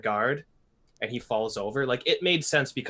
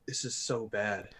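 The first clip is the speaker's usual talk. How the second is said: flat disgusted; 2 semitones lower, 6 dB quieter, slower than usual. Retold or imitated. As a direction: say it with weary disdain. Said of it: "I'm just like".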